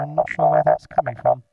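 robotic vocoder voice on a synth tone; vocoder sound. Shown as a demonstration. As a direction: talk as a buzzing, synthesised voice. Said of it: "that's the sound that the vocoder makes".

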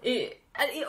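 normal talking voice

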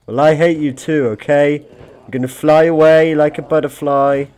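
playfully spiteful to chat; defiant taunt. Pretend. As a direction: toss it back cheekily.

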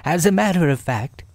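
quick, lifted storybook animal voice; Rabbit. A narrator's reading. Rabbit answering his guest, brisk high voice.

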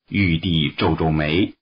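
ordinary delivery, nothing marked

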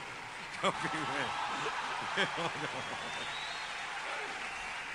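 breathy laugh. Real, not acted. Risa tras el anuncio.

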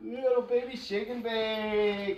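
slow drawn-out cooing. Real, not acted. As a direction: stretch the words out to the pup. Baby talk to dog.

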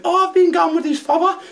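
very high mock girl voice; imitating Scruffy Lisa. Taxi driver mimicking his passenger in a very high mock voice. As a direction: squeak it out as the lass. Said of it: "She went".

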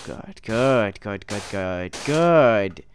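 usual speaking voice

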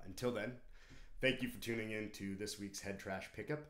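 normal talking voice

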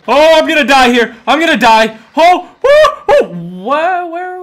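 high, loud panicked wail; panicked cries. Real, not acted. Gamer about to die in the game, wailing in panic. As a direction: cry out like you're about to die.

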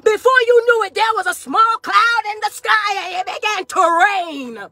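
high, intense preaching with crying outbursts; full-force preaching. Acted. To the congregation about Hezekiah, fervent high preaching. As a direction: preach it hard, climbing and wailing.

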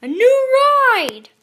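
high, drawn-out happy yell; loud, high child's cry; excited shout. A narrator's reading. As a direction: shout it slowly with delight. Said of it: "shouted Sister".

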